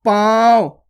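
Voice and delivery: पाँव loud, long and through nose and mouth; nasalised vowel. Shown as a demonstration. Teacher modelling for learners, loud long nasalised पाँव. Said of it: "चंद्र बिंदु की मात्रा".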